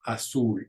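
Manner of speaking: azul with the stress on -ZUL; stress on last syllable. Shown as a demonstration. Teacher showing the accent rule, azul stressed on the last syllable. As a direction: say azul, leaning on the final syllable. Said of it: "stress goes on that last syllable".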